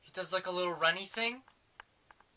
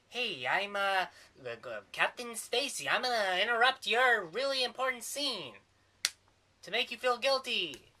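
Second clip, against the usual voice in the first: higher and louder, hammed-up interruption; a hammed-up Captain Stacy, pitched up; mock intrusive ghost; 7 semitones higher, 5 dB louder than usual. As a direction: barge into the scene like a guilt-tripping ghost.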